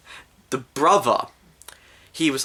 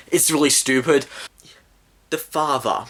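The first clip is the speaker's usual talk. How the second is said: ordinary talk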